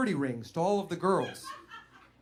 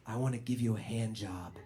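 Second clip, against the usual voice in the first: low, soft seductive murmur; soft, low come-on from Kate; quiet; 8 semitones lower, 7 dB quieter than usual. Retold or imitated. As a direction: hush it like a secret. Kate's quiet proposal.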